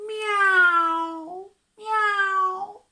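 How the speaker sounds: high rising meows; cat meow. Shown as a demonstration. Showing the child a cat noise, high meows. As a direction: meow like a kitten. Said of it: "Can you do that with me?".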